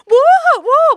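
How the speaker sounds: high, loud panicked cry; hammed-up shrieking disbelief; alarmed shout. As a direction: yelp it out in comic panic. Panicked reaction to being admitted.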